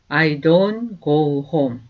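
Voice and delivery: slow, clear teacher's model, each word apart; slow demo pronunciation; slow model sentence. Shown as a demonstration. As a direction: say it slowly for students to repeat. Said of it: "이거 보세요".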